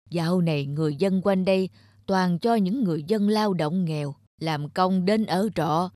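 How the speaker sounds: ông già cằn nhằn, giọng trầm; lẩm bẩm chê bai hàng xóm; ông lão khó tính. Acted. Grumpy old man character. (ông lão cằn nhằn nhẹ)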